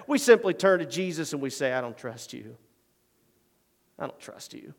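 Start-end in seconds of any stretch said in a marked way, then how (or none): 1.7-4.7 softly voiced doubting believer; hushed despair. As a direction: breathe it out as a broken doubter. Voicing despairing believers to Jesus.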